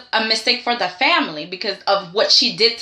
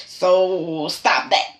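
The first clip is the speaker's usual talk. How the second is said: slow scolding; 6 semitones lower, much slower than usual. Acted.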